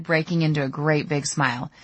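ordinary delivery

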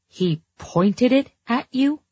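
high, slow, disbelieving question; shocked question. Acted. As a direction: slowly ask in disbelief.

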